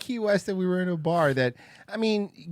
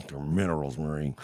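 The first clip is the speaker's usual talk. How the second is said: lower, deeper mock character voice; gruff imitation; 11 semitones lower, 5 dB quieter than usual. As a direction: drop the voice low and gruff. Second take, different voice.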